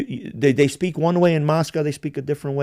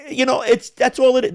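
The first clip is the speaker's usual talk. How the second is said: usual speaking voice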